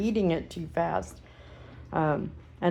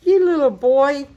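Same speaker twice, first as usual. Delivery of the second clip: higher, louder teasing pet voice; talking to a puppy; 5 semitones higher, 6 dB louder than usual. Real, not acted. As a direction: tease the puppy fondly. Cooing scold to puppy.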